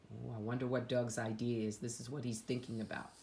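ordinary delivery, nothing marked

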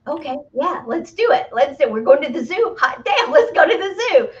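put-on a kid told they're going to the zoo; thrilled agreement. Speaker voicing eager yes to a zoo trip, gleeful and laughing.

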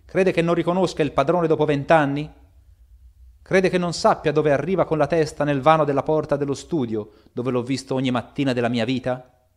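loud, high outcry of disbelief; shouting in protest. Acted. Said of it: "gridò Poole".

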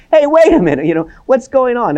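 sudden high, loud "hey, wait a minute"; startled protest. Retold or imitated. Peter protesting as dirt rains into his house, startled and loud. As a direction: jolt up in alarm as the roof comes down. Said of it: "we can imagine Peter going".

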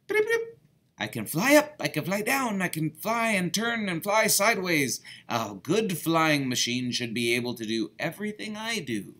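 high, bright little bird voice with trills; perky high birdsong speech; chirpy bird. Acted. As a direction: chirp it like a tiny songbird. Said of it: "chirped the bird".

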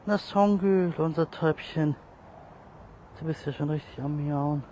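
high, loud sing-song hello to a pet; high, loud pet-talk greeting; fond greeting. Real, not acted. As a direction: greet the cat like a darling. Greeting a cat fondly.

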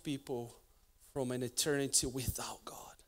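a hushed whisper. Acted. Preacher warning the church in a whisper. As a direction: let the words fall to a whisper.

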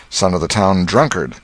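ordinary delivery, nothing marked

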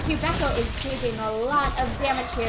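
loud, fast hyped casting; excited play-by-play. Acted. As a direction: shout the teamfight call.

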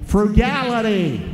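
Frugality hit hard and loud; punched word. Real, not acted. Preacher stresses Frugality to the church, loud and slow. Listing founders' virtues from pulpit.

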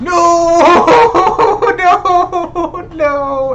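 high, loud shout of defeat; anguished no. Real, not acted. Shouting after a player says he sucks. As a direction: yell it out as you lose. Knocked down, mocked.

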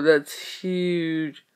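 ordinary delivery

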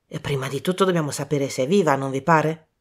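quick, businesslike Poirot; brisk tone. Acted. Poirot cutting short the young men, brisk. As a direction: hurry it, no nonsense. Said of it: "in tono sbrigativo".

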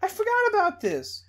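gasping, high-pitched squeal of delight; loud, very high, overjoyed outburst; fan excitement. Real, not acted. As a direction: shriek like a fan spotting a favourite. Spots favourite game among shirts.

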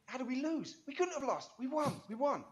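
high, insistent mock disbelief; refusing to believe. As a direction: act out a fan in denial, pitch right up. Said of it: "I refused to believe we lost".

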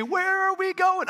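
mock the nurses' bewildered shout; nurses calling out. Nurses chasing him with wheelchair, high startled call.

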